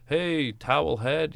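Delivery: lightly voiced heckler calling out slowly; heckler's jeer. A crowd member heckling the comic, a hint of a slow jeer. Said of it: "somebody in the crowd goes".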